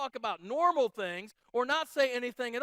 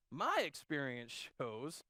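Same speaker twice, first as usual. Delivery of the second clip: smug know-it-all; 7 semitones lower, 6 dB quieter, slower than usual. Retold or imitated. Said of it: "anytime somebody says that, you should just run".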